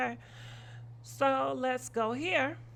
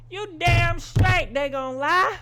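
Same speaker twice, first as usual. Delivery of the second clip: loud and high, landing hard on lie with a rise; emphatic outburst; 9 semitones higher, 8 dB louder than usual. Real, not acted. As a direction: throw the line out in an indignant outburst. Ranting about people who lie.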